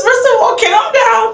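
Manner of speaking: very high-pitched acted command; high, hammed-up order to settle down; mock telling-off. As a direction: squeal it like an exasperated retelling. Acted counter lady calming her.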